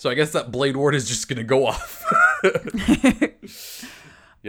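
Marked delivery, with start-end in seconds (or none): 2.1-3.9 high, loud cackling laugh; burst of laughter. Real, not acted. Player whose stealth plan failed, laughing loudly.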